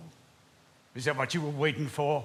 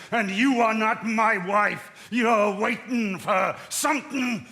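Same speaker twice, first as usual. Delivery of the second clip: rising, accusing shout; angry accusation; 4 semitones higher than usual. Acted. Husband accusing his wife, shouting angrily. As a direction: hurl the accusation at her.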